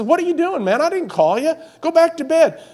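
put-on an old priest fed up at being woken; exasperated Eli.

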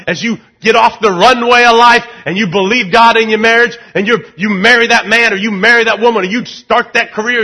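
high, loud preaching cadence; full-force preaching. Acted. Pastor urging his church through life's storms, preaching loudly. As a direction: preach it hard to the room.

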